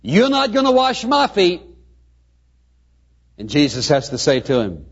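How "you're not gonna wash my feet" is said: high-pitched, indignant refusal; staged protest, voice pitched well up; acted protest. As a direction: object as Peter, pitch held high. Peter refuses foot washing.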